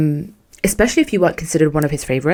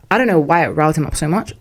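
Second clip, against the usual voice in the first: normal talking voice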